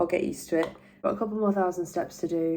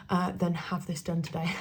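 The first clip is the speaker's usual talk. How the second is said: ordinary talk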